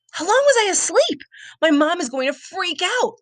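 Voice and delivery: high, fast panicked thoughts. A narrator's reading. Boy locked in the dark school, panicking high and fast. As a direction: rush it out in rising panic.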